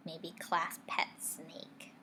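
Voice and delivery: ordinary delivery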